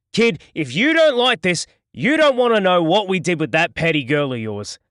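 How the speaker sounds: loud, high gloating threat; cruel taunt. A narrator's reading. Duran taunting Jason about his girl, loud gloating threat. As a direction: rub it in with relish.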